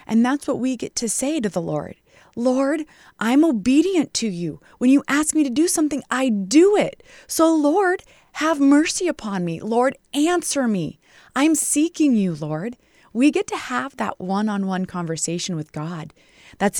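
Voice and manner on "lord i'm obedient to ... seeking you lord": heartfelt pleading prayer; earnest prayer. Acted. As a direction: pray it out as if pleading face to face. Voices a prayer to the Lord.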